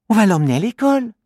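put-on high child voice, very fast; quick little girl. Alori deciding to take him to school, fast girl voice. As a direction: blurt out the plan. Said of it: "répond Alori".

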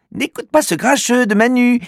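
put-on high, childlike girl's voice; a little girl taking her friend's side. Little girl Alori telling the dog to ignore her brother, childlike and high. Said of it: "dit Alori".

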